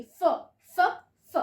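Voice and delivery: फ said alone as a short breathy sound, repeated; phonics sound demo. Shown as a demonstration. Teacher to children drilling the फ sound, short and separate. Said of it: "फ की तरह पढ़ेंगे".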